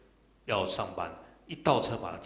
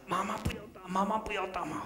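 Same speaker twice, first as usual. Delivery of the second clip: put-on high little-child voice pleading; imitated crying child; 12 semitones higher than usual. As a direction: mimic a child begging not to be hit, pitched way up. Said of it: "里面小孩子在哭啊，惨叫啊". (模仿小孩尖高的哭求声)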